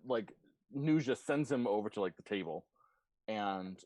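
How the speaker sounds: ordinary talk, nothing marked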